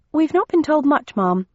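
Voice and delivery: high, respectful storybook sister; respectful Bennett sister. Acted. Bennett sister reporting her instructions, high-voiced and respectful.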